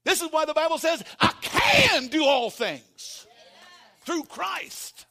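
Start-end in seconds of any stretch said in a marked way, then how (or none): 1.2-5.1 slow, loud, high declaration; proclaimed. Acted. Preacher proclaiming the Bible verse to the church, slow and loud. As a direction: drag each word out for the crowd.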